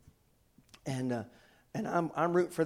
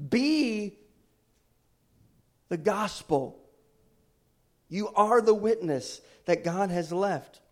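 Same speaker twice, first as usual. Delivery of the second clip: loud, higher fervent sermon voice; full-force preaching; 7 semitones higher, 8 dB louder than usual. Acted. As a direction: preach hard to the congregation.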